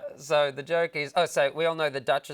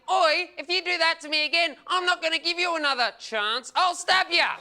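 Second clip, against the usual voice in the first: high, loud threatening yell; mock threat; 11 semitones higher, 5 dB louder than usual. Comic retelling an overheard Adelaide guy, loud mock threat. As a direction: imitate a feral threatening loudly. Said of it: "I overheard a guy saying".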